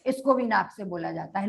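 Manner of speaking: hurried quiet repetition for the class; quick soft repeat. Shown as a demonstration. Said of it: "इसका उच्चारण लिखो".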